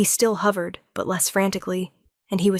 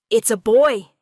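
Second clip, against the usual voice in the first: louder proud announcement; announcing; 3 semitones higher, 7 dB louder than usual. A narrator's reading. Healer announcing the birth to the parents, loud. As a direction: call it out to the whole room. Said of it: "the healer announced".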